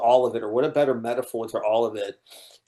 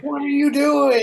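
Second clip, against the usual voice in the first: high, loud re-enacted scream; 12 semitones higher, 4 dB louder than usual. Viewer berating the movie at home, high exasperated yelling. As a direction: yell at the characters on screen as if they could hear. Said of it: "I'm screaming at the T V".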